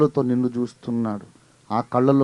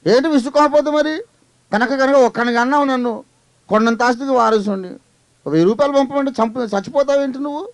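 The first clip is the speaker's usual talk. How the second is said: high, louder irritated outburst; annoyed son; 12 semitones higher, 4 dB louder than usual. Retold or imitated. Son snaps at his rich father, high and loud. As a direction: snap back at the father in a mock rage. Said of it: "ఏంట్రా అంత విసుక్కుంటున్నావు".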